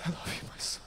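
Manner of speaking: unvoiced whisper. Man retelling God's words, whispered tenderly. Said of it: "he said".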